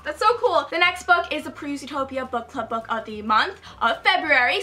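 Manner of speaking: normal talking voice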